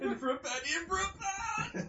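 high excited proclamation; exclaiming. Real, not acted. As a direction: announce it triumphantly. Proclaimed proverb.

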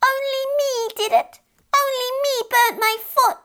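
crying back up the chimney in a shrill squeal; squealing fairy child. Acted. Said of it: "the fairy child cried back, squealing".

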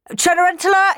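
high, loud summons; shouted call across the house; yelling. A narrator's reading. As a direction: yell for a servant. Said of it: "he yelled".

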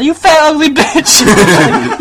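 high, loud, drawn-out jeer; mock insult. Father trolling his daughter online, loud mocking yell. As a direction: hurl it slowly, breaking into laughter.

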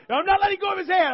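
high, loud mock panic; hammed-up frantic refusal; imitated panicked wife. Terrified wife clutching hand on plane.

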